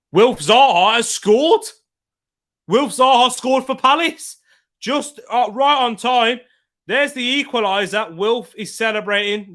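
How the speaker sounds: high, loud burst of excitement; excited goal call. Real, not acted. Reacting live to a goal.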